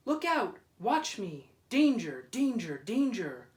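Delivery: loud, urgent calls of alarm; lighthouse warning call. Acted. Lighthouse warning the boats, loud urgent call. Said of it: "spoke out plainly".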